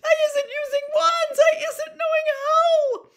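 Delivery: very high, loud, wailing elf squeal; Winky. A narrator's reading. Terrified house-elf pleads her innocence, shrieking squeal. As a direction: escalate into terrified, tearful shrieking. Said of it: "she squealed".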